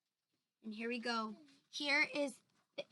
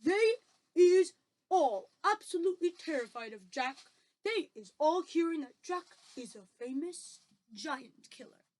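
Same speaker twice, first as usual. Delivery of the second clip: emphatic giant character, opening loud then easing; BFG, earnest; 4 semitones higher, 8 dB louder than usual. Acted. BFG tells Sophie what giants fear, earnest giant voice. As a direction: explain it gravely, weighting the key words.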